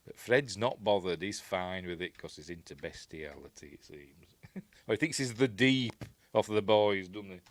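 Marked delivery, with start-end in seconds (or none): 2.2-4.3 whispered aside. Real, not acted. Whispered aside after a joke.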